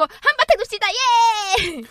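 high, loud rallying shout; party cheer. Retold or imitated. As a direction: whoop like a host firing up the crowd. Acts out event host rallying players.

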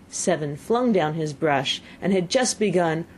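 usual speaking voice

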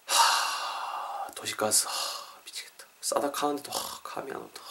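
hushed, sighing lament over a bill; despairing sigh. Acted. Acted sighing over gas bill. (한숨 쉬며 낙담한 목소리로)

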